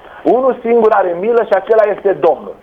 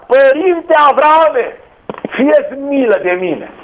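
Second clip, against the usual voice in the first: slow, higher drawn-out plea; pleading cry; 4 semitones higher, slower than usual. Acted. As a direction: beg from the flames, stretched out. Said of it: "a strigat".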